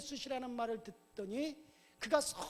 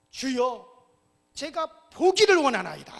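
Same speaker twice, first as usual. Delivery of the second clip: unhesitating; 3 semitones higher, 16 dB louder than usual. Acted. Blind beggar tells Jesus he wants sight, unhesitating cry. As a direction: shout the wish out at once, full force. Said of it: "주저없이".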